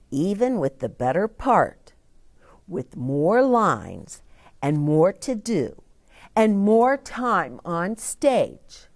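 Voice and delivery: ordinary delivery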